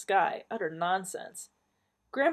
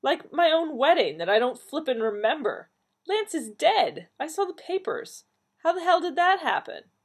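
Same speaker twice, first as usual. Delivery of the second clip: high, loud, indignant outburst; upset outburst; 10 semitones higher, 6 dB louder than usual. A narrator's reading. Donna confronting family about wedding and Lance's death, high loud outburst. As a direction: let it burst out, loud and pitched up.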